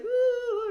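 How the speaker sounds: high, loud mock wail; sobbing wail. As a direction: wail it out like a crying fit. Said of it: "I'm just like".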